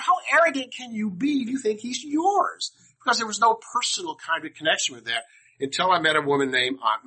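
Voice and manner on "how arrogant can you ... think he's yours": put-on indignant young self. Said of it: "And I thought".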